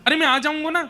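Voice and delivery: high-pitched, pleading little son; child consoling mother. Acted. Krishna clings to weeping Yashoda, high pleading reassurance.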